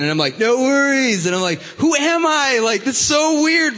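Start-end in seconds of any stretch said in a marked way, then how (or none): 0.4-1.3 higher, louder mock Australian; Australian accent. Speaker catching himself copying a coworker, Australian accent. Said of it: "talking like an Australian accent". 1.8-3.8 high, loud mock bewilderment; bewildered. Speaker startled by his own borrowed accent, mock bewildered.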